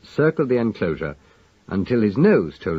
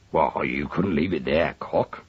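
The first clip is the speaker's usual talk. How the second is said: cockney Lugg; 4 semitones lower than usual. A narrator's reading. Lugg's cockney voice.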